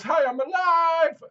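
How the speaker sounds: high, drawn-out singsong cry; mock cheery call. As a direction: imitate a mum popping up, loud and high. Mum suddenly appears, retold.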